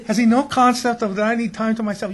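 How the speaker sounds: high, put-upon whine with a laugh; mock self-pitying grumble; whining inner voice.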